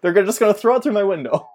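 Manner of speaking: ordinary delivery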